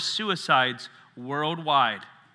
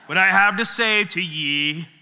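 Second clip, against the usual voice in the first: slow, grand mock-royal proclamation; pompous Monty Python lord; 5 dB louder, slower than usual. Pastor mocking a preacher who thinks he's the best, grand mock decree. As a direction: ham it up like a self-important king. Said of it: "some Monty Python kinda thing".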